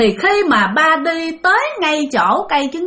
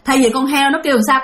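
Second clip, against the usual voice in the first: ordinary delivery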